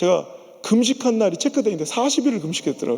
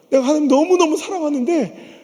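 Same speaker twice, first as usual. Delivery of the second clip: ordinary talk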